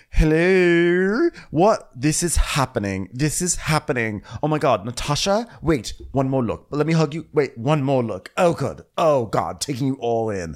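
high, theatrical impression of a gushing man; Kyle. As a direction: make a campy, overexcited grand entrance.

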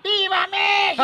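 high-pitched patriotic cheer; cheering shout. Pretend. Host cheering Mexico to tease the caller, high shout. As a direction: cry it out high, holding the vowels.

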